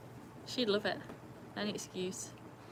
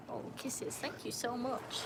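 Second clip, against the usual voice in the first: ordinary delivery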